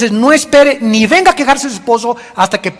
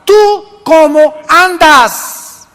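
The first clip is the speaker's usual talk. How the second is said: ¿Tú cómo andas? drawn out slow and louder; slow emphatic question; 4 semitones higher, 4 dB louder, much slower than usual. Shown as a demonstration. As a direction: stretch each word of ¿Tú cómo andas?. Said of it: "no me digas de tu papá".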